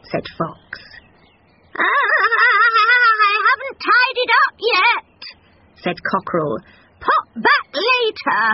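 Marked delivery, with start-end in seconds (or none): 1.7-5.2 very slow, high, loud fluster; fluttery cockerel excuse. Acted. Cockerel making excuses to the fox. 7.0-8.4 very slow, high, loud fluster; fluttery cockerel excuse. Acted. Cockerel making excuses to the fox.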